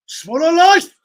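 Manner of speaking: high, loud mock Turkish accent; Turkish kebab worker. Kebab man asks walk-in customer his size, loud Turkish accent. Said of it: "Someone walk in".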